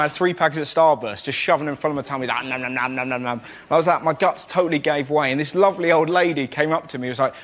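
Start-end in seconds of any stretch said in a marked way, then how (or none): 2.5-3.4 munching noises. Retold or imitated. Eating sweets noise.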